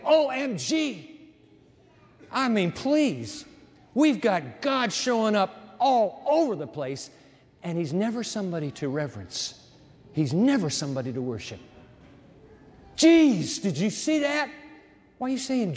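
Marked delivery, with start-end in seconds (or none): 0.0-1.3 high, drawn-out mock gasp of amazement; mock astonishment. Texter reacting to a slam dunk, mock-amazed. Reacting to good news by email. 13.0-14.5 high, loud burst of astonishment; mock amazement. Onlooker to a friend at a sight, mock-astonished. Acting out a casual exclamation.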